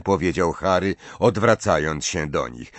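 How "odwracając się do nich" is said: plain reading voice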